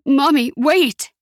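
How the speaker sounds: high, slow pleading yell; desperate shout, words drawn out. A narrator's reading. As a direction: call out to stop her hanging up.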